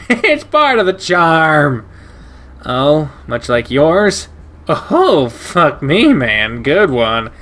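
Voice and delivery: a cheerful big dog sizing you up; brash shepherd voice. Acted. Ryan cackles, replies.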